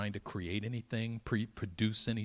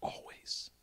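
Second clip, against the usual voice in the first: a single breathed whisper; whispered word; 9 dB quieter than usual. Acted. Preacher hushing the room, whispered. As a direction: let it fall as a secret.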